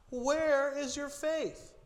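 slow, high, drawn-out gentle rebuke; rebuking Jesus. Retold or imitated. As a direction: let the question hang, high and slow. Jesus rebukes scared disciples.

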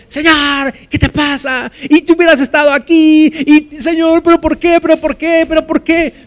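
high-pitched, hammed-up wailing complaint; agitated Marta. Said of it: "pero tal vez Marta llegó".

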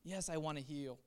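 a hint of a gentle, compassionate Jesus; tender Jesus. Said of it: "But he's like".

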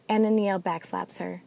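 ordinary delivery

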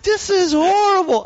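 high mock disgust; hammed-up dismay at a bad show. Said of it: "And I'm like".

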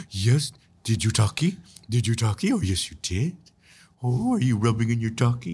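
playful mock-coddling baby voice; pet baby talk. Host treating haters as little puppies, baby talk. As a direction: coddle a naughty puppy with babyish words. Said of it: "you imagine that they're little bunny rabbits or little puppy dogs".

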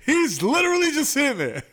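high, hammed-up shouting with laughter; exasperated gamer yell. Gamer warning teammates about a camper, exasperated mock shout. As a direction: yell it high in comic disbelief. Acting out a frustrated gamer's callout.

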